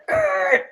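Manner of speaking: short, very high loud noise; imitated screech. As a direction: squeal out a quick "er". Acts out braking or reaction.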